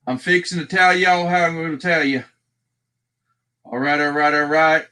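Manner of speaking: hammed-up Southern folksy talk; playful Southern accent; put-on Southern accent. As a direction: exaggerate a Southern twang. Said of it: "I will talk like this".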